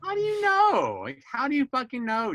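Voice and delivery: very high, loud disbelieving outburst; exasperated challenge. Retold or imitated. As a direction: re-enact snapping back at Dad in indignation. Retelling exasperated challenge to Dad.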